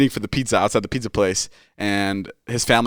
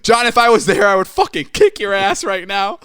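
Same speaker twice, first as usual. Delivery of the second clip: much higher, louder hammed-up threat; mock angry threat; 10 semitones higher, 6 dB louder than usual. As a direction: threaten him like a fuming friend. [name] threatening John on phone.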